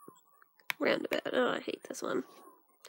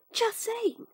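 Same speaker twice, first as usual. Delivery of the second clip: much higher, playful squeak-up; high-pitched repeat; 9 semitones higher than usual. Acted.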